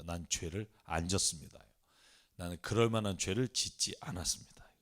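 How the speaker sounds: low, hushed retold plea of innocence; decisive denial. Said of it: "하나님 앞에 결정적으로 말하죠". (낮고 조용하게 결백을 단언하기)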